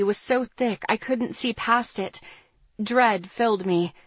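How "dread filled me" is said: usual speaking voice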